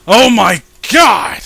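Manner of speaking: loud, slow startled shout. Real, not acted. Reader shouting at a shocking comic panel. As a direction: bellow it out in shock.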